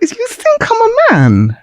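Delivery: high, slow, crying out in disbelief; indignant shriek at being called a man; outraged disbelief. Retold or imitated. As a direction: stretch it out, shocked and hurt. Shocked at being mistaken for man.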